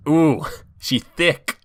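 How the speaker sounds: high, slow sassy drawl; teasing, drawn out; a mock-sassy aside; sassy remark. Real, not acted. Reacting to thick accent joke.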